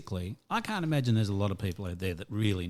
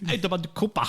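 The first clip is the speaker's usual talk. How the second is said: mock German accent, higher pitch; 7 semitones higher than usual. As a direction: say the name like a German would. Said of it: "funny German accent doesn't make it German".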